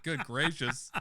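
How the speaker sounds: normal talking voice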